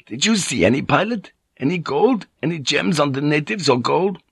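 high, eager questioning with rising pitch; eager merchant. Acted. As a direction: press the pilot hopefully, voice lifted. Van Neck eagerly asks about gold.

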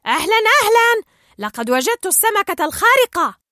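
high, loud, hearty greeting; excited pelican. A narrator's reading. Nigel bursts through the window, loud excited pelican call. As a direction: call out a big excited hello.

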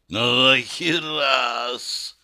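slow, drawn-out dying rasp; wheezing creature. Acted. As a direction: croak it out with last breath. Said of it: "прохрипела тварь". (хрип умирающей твари)

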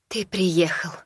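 ordinary delivery

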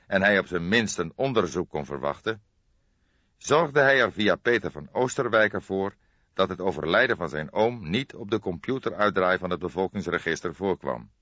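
ordinary delivery, nothing marked

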